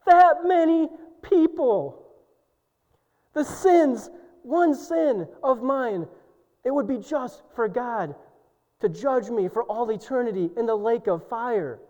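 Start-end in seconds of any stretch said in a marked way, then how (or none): 0.1-9.3 high, loud preaching cry; impassioned cry. Real, not acted. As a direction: cry it out in awe. Preacher at peak intensity.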